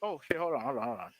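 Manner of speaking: faint, distant, barely reaching the mic; speaking from across the room; far away. Real, not acted. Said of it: "You sound very far away".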